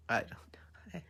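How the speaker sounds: unvoiced, hushed I don't know; a private whisper to the screen; whispered aside. Real, not acted. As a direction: drop to a breathy whisper, half to yourself. Muttering aside while watching.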